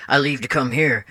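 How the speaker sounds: ordinary talk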